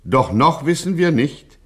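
ordinary talk

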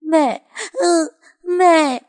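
put-on high, loud, choked child's sob; sobbing child. A narrator's reading. Monkey sobs in Phượng's arms, choked and high. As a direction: sob out the word mother. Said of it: "tức tưởi không lên lời".

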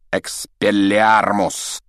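slow, drawn-out incantation; exclaimed spell. A narrator's reading. Professor disarming his duel opponent, exclaimed incantation. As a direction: cast the spell with a long, forceful cry. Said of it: "Снег воскликнул".

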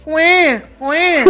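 put-on high little baby wail; mock baby cry. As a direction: cry like a baby, half-laughing. Imitando o choro do bebê. (choro de neném imitado, agudo e brincalhão)

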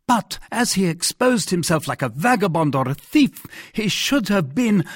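high, heated monarch breaking off; agitated king. Acted.

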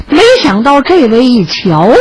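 usual speaking voice

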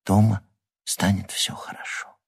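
unvoiced whisper of Дома станет всё хорошо. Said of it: "прошептала Лиза".

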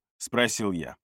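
usual speaking voice

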